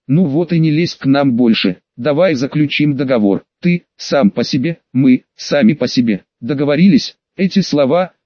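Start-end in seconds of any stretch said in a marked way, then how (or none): none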